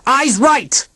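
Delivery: high shouted order; yelled command. A narrator's reading. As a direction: bark it like a drill call. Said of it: "I yelled".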